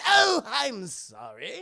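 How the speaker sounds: high, loud, drawn-out insincere sorry; hammed-up fake contrition; mock apology. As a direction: stretch out a sarcastic apology. Driver's mock apology to Bruce.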